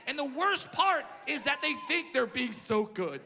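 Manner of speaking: normal talking voice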